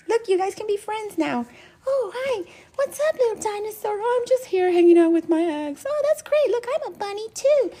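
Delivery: very high squeaky play voices; voicing toys making friends; cute toy voices. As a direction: play the little figures chatting. Voices the little toy figures.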